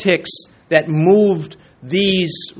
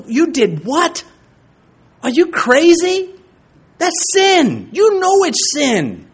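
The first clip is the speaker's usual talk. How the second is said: higher, louder shocked rebuke; blunt scolding; 10 semitones higher, 4 dB louder, slower than usual. Retold or imitated. Layman scolding a pastor over sin, loud shocked rebuke. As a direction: blast a church member for sinning. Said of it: "if I said it like that to someone".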